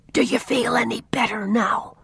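soft unvoiced whisper. A narrator's reading. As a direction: breathe it without voice. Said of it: "whispered Jerry".

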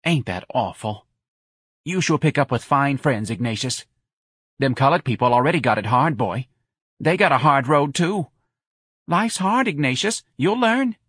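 a mother lectures her son that life's hard; Missus Riley scolding. A narrator's reading. Mother lectures son about hard lives, high pitched.

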